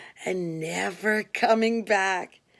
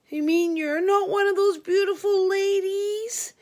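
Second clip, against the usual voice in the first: much higher, whining disappointed voice; whiny Frankie; 12 semitones higher, 3 dB louder than usual. Retold or imitated. Inmate Frankie whining that she isn't one of the phone ladies. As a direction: whine it like a let-down boy. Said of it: "he whined".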